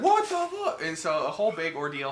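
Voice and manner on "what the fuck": shocked squeak. Retold or imitated. Andy shocked retold.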